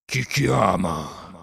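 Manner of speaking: very slow, drowsy tiger voice; sleepy tiger. Acted. Shere Khan answering Mowgli's call from the ravine, slow sleepy growl. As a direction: drag it out like a sated beast just woken. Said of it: "lungo ruggito assonnato della tigre".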